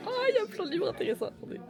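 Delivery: high, fast playful character voice; squeaky put-on voice. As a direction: pitch it way up and rush it.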